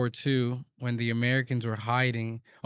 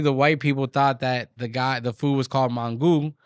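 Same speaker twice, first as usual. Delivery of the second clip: ordinary delivery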